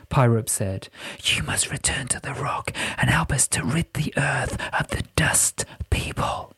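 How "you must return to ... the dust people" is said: whispering spirit. Acted. Pyrope orders Jessica back to the rock, whispered. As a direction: whisper it as a solemn command.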